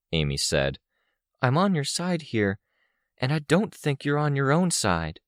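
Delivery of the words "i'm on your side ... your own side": higher-pitched voice, insisting; earnest Amy. A narrator's reading. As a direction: plead your loyalty in a high voice. Narrator voices Amy pleading with Vicky.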